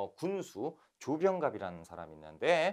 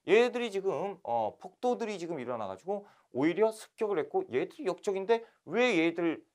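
higher, heated lecturing tirade; indignant official rant; 7 semitones higher, 4 dB louder than usual. Retold or imitated. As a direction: berate them like a corrupt envoy. Envoy Lee Yongtae's angry rant. (이용태가 관리들에게 분개하며 호통)

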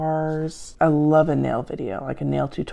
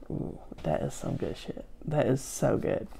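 hushed savoring; 4 semitones lower, 9 dB quieter than usual. Real, not acted. Savoring her favorite soda.

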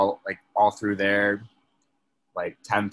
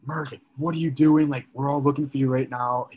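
higher, louder scolding of a lost friend; scolding Merc after the panicked search, loud and high; angry outburst; 6 semitones higher, 4 dB louder than usual. Retold or imitated. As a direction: tear into him, pitched up and loud. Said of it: "And I just unleash".

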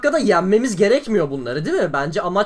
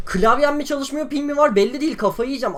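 ordinary talk

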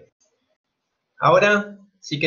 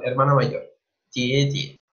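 ordinary talk

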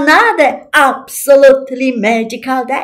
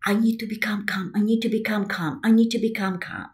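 fast, softer, whispered chant of self-instruction; whispered mock self-talk; 4 semitones lower, 10 dB quieter, faster than usual. Mocking someone forcing themselves to calm down, whispered. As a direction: mutter it like forced self-coaching.

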